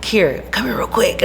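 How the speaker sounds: hushed whisper. Retold or imitated. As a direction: whisper it like a secret. Bouncer pulls her aside secretly.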